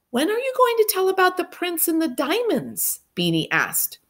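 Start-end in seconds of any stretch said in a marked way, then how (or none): 0.1-3.0 put-on high, light little-girl voice; eager child. A narrator's reading. Girl asking about the prince, high eager child voice. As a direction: ask it like a small keen kid.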